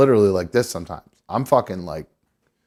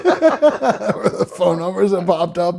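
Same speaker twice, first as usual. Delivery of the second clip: laughing; 6 semitones higher, slower than usual. Real, not acted. Laughing at a Google search.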